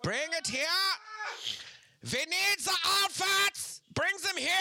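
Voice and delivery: loud, high German movie villain scream; German accent. Acted. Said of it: "do a German accent when they scream".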